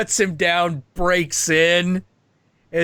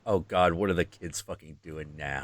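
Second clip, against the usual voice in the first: low, soft tired grumble; weary parent god; 11 semitones lower, 10 dB quieter than usual. Retold or imitated. Vishnu groaning at his children, low weary mutter. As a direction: sigh it out like a tired dad.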